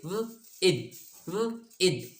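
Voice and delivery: व then इद, split twice; syllables separated for students; sounded out. Shown as a demonstration. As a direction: break विद into व and इद. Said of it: "ये लफ्ज़ बन गया विद".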